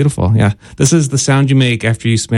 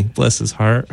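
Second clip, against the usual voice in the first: mock southern pity; 3 semitones lower, 4 dB quieter, slower than usual. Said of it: "isn't he?".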